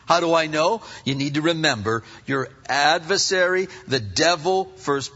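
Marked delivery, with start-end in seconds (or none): none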